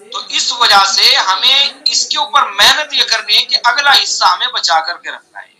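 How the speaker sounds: normal talking voice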